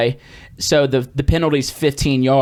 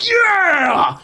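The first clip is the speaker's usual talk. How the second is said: triumphant shout; 14 semitones higher than usual. Retold or imitated. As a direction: shout it after the big run. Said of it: "just goes".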